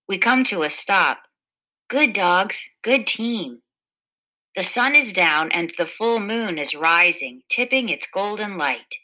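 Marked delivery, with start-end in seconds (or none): none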